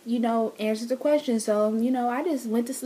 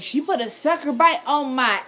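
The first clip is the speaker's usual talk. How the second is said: loudly mimicking him; loud imitation; 4 semitones higher, 6 dB louder than usual. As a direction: boom it out like he did. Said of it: "you said it loud, like how I imitated".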